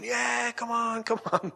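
high, stretched mock cheer without full volume; crowd egging on. Everyone tells the runner to keep going, imitated drawn-out cheer. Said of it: "telling him to keep going".

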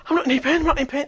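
high, loud, astonished cries; retold shock. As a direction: act out her disbelief at full voice. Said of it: "She was in shock".

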